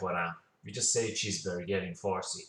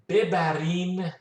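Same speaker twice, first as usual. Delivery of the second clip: ببرین said slowly and higher, each syllable clear; slow clear model; 7 semitones higher, 5 dB louder, slower than usual. Shown as a demonstration. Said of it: "ببرین is plural".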